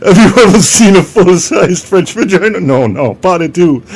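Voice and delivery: put-on loud, high comic French accent; hammed-up French accent. French vagina character addresses visitors, loud hammed-up French accent. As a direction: boast loudly like a cartoon French host.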